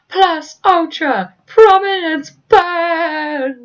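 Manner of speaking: high, loud, slow drawn-out shout; battle shout. Retold or imitated. Endeavour unleashing his ultimate move, battle shout. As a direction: bellow the attack name, stretching each word.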